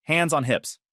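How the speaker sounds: ordinary delivery, nothing marked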